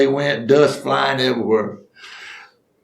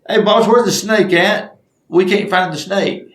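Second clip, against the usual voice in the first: higher-pitched holler to the boss; raised, pestering call; acted calling out; 7 semitones higher, 3 dB louder than usual. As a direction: call across the room to your boss.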